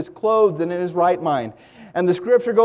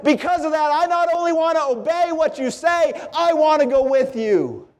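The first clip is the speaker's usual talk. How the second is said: high-pitched, earnest declaration; preacher voicing a convert's eager vow; fervent devotion; 8 semitones higher than usual. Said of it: "And he said".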